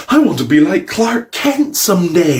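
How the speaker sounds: put-on high-pitched second child voice; mock kid declaring a dream; other little kid. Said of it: "then there's the other kid".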